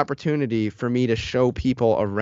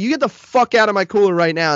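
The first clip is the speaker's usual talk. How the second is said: higher, heated command; ordering him out; 8 semitones higher, 4 dB louder than usual. Retold or imitated. Kicking the driver out of the cooler, heated. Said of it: "I was like".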